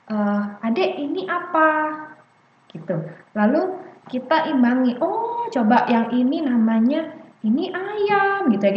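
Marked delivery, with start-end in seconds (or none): 0.7-1.7 put-on parent asking child. Shown as a demonstration. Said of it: "Contoh kita tanya". 5.0-8.5 parent teaching words. Shown as a demonstration. Parent reading a book with a child, sing-song naming. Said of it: "Kita lagi baca buku nih misalnya".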